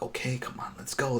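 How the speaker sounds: soft hurried whisper. Retold or imitated. Percy urging Iris to leave, whispered. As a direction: urge her along under your breath. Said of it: "He's like".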